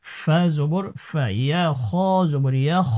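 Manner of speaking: ordinary talk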